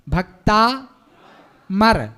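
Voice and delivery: ordinary talk, nothing marked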